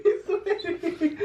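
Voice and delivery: high, slow, cracking up; laughing. Real, not acted. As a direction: howl it through laughter. Friend sweating from hot wings.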